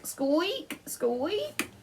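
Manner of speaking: high, squeaky wheel noise; squeaky wheel imitation; high squeak. A narrator's reading. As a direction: mimic a squeaky pram wheel.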